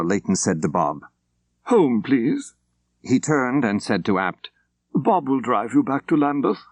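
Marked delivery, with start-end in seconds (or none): 1.6-6.6 a higher, clipped-free commanding gentleman; crisp official. Acted. Layton telling his driver Bob to go home, crisp official. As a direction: give the order briskly. Layton, MI5 man, voiced.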